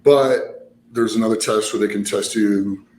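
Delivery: normal talking voice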